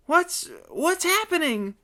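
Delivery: high, frightened stammer; whimpering. Acted. Honky cowering in a heap, whimpering fearfully. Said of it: "whimpered Honky".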